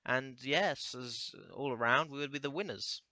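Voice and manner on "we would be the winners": ordinary talk, nothing marked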